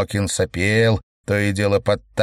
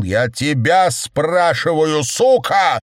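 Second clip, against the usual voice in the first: slow, loud, much higher angry yell; shouting in anger; 7 semitones higher, 4 dB louder, slower than usual. A narrator's reading. Secretary yells at the cowering chairman, furious. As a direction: bark the insult at him. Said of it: "закричал Кедрин".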